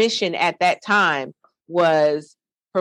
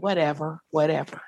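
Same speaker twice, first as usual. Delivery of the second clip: dismissive; 2 semitones lower, 5 dB quieter than usual. Retold or imitated. Brushes off pastor's teasing remark.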